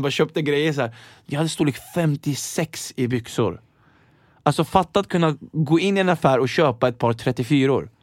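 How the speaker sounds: ordinary talk, nothing marked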